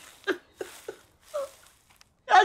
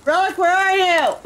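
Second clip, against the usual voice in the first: louder call for teammate; 6 semitones higher, 6 dB louder than usual. Real, not acted. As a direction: shout for a lost friend. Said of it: "Not too loud, though".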